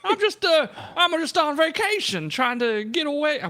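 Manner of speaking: put-on NPC voice with wandering accent; drifting accent. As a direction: let the accent slip around. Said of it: "Where is my accent going?".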